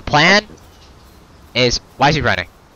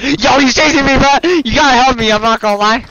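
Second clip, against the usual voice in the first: being chased; 9 semitones higher, 4 dB louder than usual. Real, not acted. Chased, begging for help.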